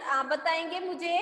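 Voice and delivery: ordinary talk, nothing marked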